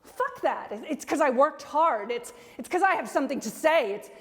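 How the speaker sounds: high, heated outburst. Acted. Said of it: "I'll raise my voice".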